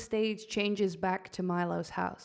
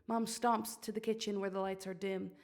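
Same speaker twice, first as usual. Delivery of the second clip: normal talking voice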